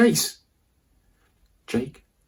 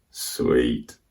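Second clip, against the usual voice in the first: low satisfied; 13 semitones lower, 3 dB quieter than usual. Acted. Satisfied at brother's scolding.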